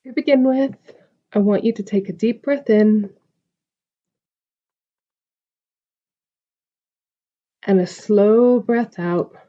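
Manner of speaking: calm, spacious meditation delivery; guided meditation. Acted. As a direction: guide the listener gently into stillness, stretching the breath cues. Leads a meditation.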